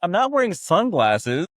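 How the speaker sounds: high, loud retold objection; mock-outraged comeback; incredulous protest. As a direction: protest in disbelief, pitched well up. Retold protest over sunglasses demand.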